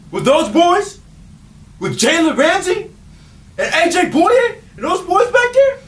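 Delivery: mock outrage that builds phrase by phrase, 'boys' rising high; incredulous outrage. Co-host disputes the pick.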